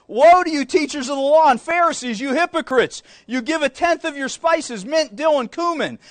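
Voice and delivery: preacher thundering at the hypocrites; forceful scripture reading. Acted. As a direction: denounce the hypocrites from the pulpit. Preacher reads Jesus' rebuke.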